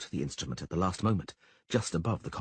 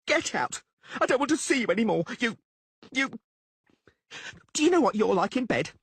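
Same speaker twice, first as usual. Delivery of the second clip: high, fast, louder furious screaming; 13 semitones higher, 3 dB louder than usual. Acted. Blonde woman screams insults at Dandelion before onlookers. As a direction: humiliate him before the crowd.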